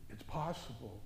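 soft, low It's possible; sudden quiet preacher aside; hushed. Acted. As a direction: drop to a near hush. Quiet contrast after build-up.

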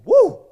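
sudden high loud whoop; excited whoop. Real, not acted. Preacher cheers for football season with a loud whoop. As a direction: cheer like a fan in the stands.

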